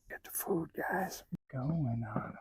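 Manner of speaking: hushed whisper so the mice don't hear; whisper, don't scare them off; breathy hunting whisper. Real, not acted.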